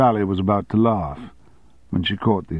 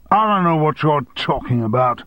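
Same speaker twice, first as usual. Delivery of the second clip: higher, raised denial; shouted; 7 semitones higher, 4 dB louder than usual. A narrator's reading. Boss denying the affair, shouted. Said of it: "shouted Armstrong".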